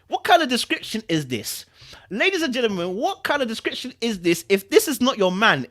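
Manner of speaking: who writes this, come on; incredulous. Real, not acted. Reader ranting to viewers about the love interest, incredulous.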